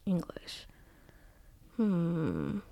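whispered to herself; soft whisper while thinking. Real, not acted. Thinking over the quiz word.

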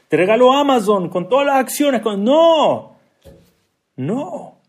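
high, loud mock outrage; emphatic refusal. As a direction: refuse the offer at full pitch. Mock haggling, refused with rising force.

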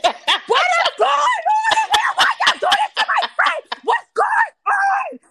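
high, loud outraged shouting; furious shout. Retold or imitated. Friend yelling at the leasing office lady, high and outraged.

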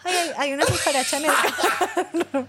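high, polite little alarm; dainty calm act. Retold or imitated. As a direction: play it cute, not hysterical. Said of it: "solamente dije".

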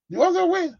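very high falsetto squeal; squeaky taunt. Acted. Preacher answering Satan's challenge, very high mocking squeak. Mocking the devil's wish to win.

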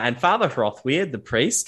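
normal talking voice

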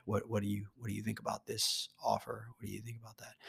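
soft, hushed late-night DJ voice; late-night DJ whisper. Shown as a demonstration. Demoing the whisper effect to the audience, hushed and soft. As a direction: lean in and hush it like a night DJ. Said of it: "or the whisper effect".

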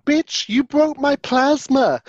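hammed-up Betty yelling at the door; mock outrage. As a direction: spit it out like a furious neighbour. Quoting Betty's line at the door.

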